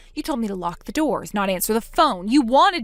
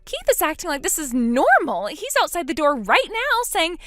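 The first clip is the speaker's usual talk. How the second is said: higher, indignant disbelief fading into fear; outraged at her family, then scared of Keith; shocked younger sister; 7 semitones higher than usual. Acted.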